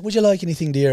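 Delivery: high-pitched Irish trolley lady; imitated Irish woman. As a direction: mimic an Irish woman serving. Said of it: "and she's like".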